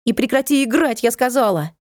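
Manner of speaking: high stern call; stern shout. A narrator's reading. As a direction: order the kid to stop. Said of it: "крикнула Натка". (строгий окрик)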